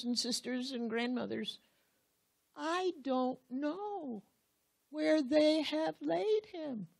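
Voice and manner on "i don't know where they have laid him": grieving lament. Acted. Mary grieves that she cannot find his body, slow and high. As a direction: let the grief pull the words out slowly.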